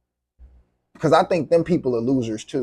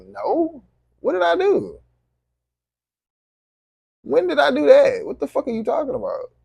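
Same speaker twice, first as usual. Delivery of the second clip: incredulous denial; 10 semitones higher than usual. Acted. Incredulous reply to an accusation.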